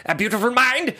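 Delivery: high put-on voice of the math prodigy; high, far, hammed-up genius predicting it; mock genius voice. Mocking the scientist's prediction in a high put-on voice. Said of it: "But he was like".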